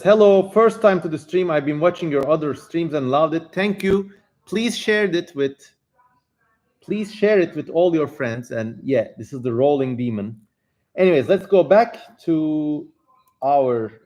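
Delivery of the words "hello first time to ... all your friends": louder, higher read-out voice; reading chat aloud. Acted. Streamer reading a viewer's chat message, upbeat read-out.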